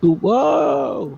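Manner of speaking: loud, rising whoa as if falling; mock falling whoa. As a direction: yelp like losing balance. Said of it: "That was me falling over".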